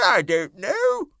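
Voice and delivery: high, slow grasshopper. A narrator's reading. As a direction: shrug it off like a bug. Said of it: "the old green grasshopper said".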